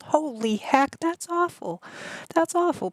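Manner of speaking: high-pitched, appalled reaction; shocked sympathy. Real, not acted. Streamer reacting to a chatter's awful news, shocked sympathy.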